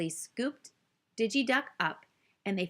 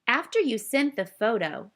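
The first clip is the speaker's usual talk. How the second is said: ordinary delivery, nothing marked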